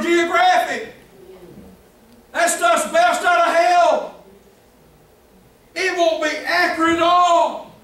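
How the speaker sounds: full-force pulpit warning; fiery preaching. Acted. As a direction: preach it hard at the congregation.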